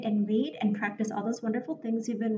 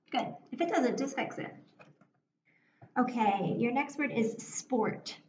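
ordinary delivery

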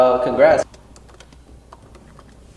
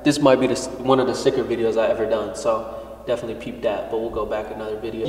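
normal talking voice

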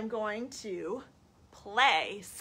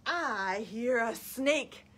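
put-on reading to children; 2 semitones higher, 5 dB louder, much slower than usual. Storytime answer about a snake, slow and drawn-out. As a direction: stretch the hiss in HISSING. Reads picture book to children.

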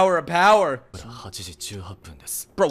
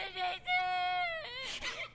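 wheezing laugh; 13 dB quieter than usual. Real, not acted. High crying laugh.

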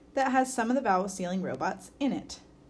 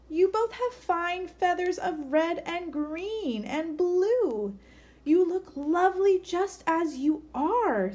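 reassuring, higher and louder sing-song mum; higher mum bird voice; 8 semitones higher, 5 dB louder than usual. Acted. Mum bird tells her twins they look lovely, higher and warm. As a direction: soothe them like a proud bird mother.

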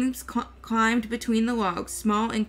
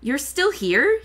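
exasperated; 4 semitones higher, 4 dB louder than usual. A narrator's reading. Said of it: "looking embarrassed and a little exasperated".

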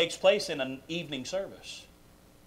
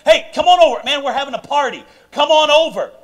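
loud, high party invitation; calling friends over; 9 semitones higher, 12 dB louder than usual. Acted. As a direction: holler an eager invite to your buddies.

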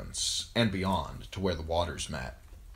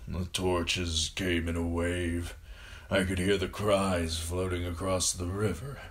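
put-on slow, labouring old man's voice; dying father, wistful. A narrator's reading. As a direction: recall it weakly, pausing between phrases. Dying father recounts the battle.

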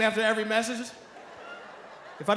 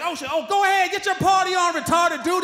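thugs voice; 7 semitones higher than usual. Retold or imitated. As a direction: wave him through like impressed thugs. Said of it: "They're like".

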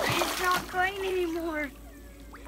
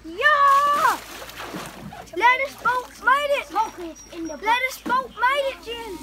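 excited cheer; 7 semitones higher, 6 dB louder, slower than usual. Real, not acted. Boat toy floats, cheering.

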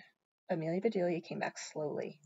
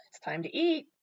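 higher call with a rising end; 7 semitones higher, 3 dB louder than usual. A narrator's reading. Amelia Bedelia calling the Rogerses to eat, calling out. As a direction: call them over to the meal, lifting the pitch. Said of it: "Mister and Missus Rogers called Amelia Bedelia".

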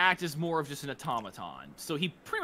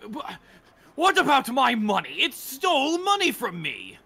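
put-on indignant drunk; 8 semitones higher, 7 dB louder than usual. Drunk guest complains loudly.

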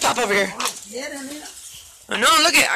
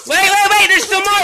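excited; 7 semitones higher, 4 dB louder than usual. Real, not acted. Excited as candy keeps falling.

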